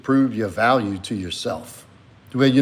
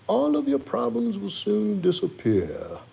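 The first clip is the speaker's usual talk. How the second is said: pet's voice; 9 semitones higher than usual. Acted. Streamer voices his dog saying good night to chat, high pet voice. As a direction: speak as the pet, squeaky and sweet.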